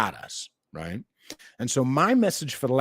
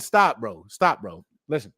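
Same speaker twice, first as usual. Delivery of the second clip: ordinary delivery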